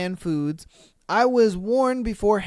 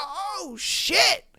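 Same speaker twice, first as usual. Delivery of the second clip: high, softer stunned reaction; mock shock; 9 semitones higher, 4 dB quieter than usual. Onlooker seeing the blow land, mock shocked high voice. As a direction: react aghast, pitched up and hushed.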